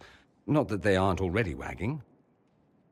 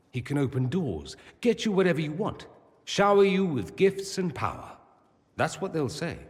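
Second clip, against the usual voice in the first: building to high, louder agitation; agitated rant; 7 semitones higher, 4 dB louder than usual. Acted. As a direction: build bitterly, voice climbing high. Bitter argument about gossip over favours.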